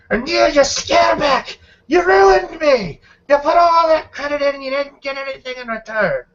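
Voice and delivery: high, shouted blame from a ruined gambler; enraged accusing gambler. Acted. Enraged gambler turns on another player.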